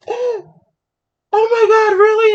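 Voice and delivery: loud, very high shocked squeal; shocked friend. Retold or imitated. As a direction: mimic a friend's horrified disbelief. Retold friend's shocked reaction.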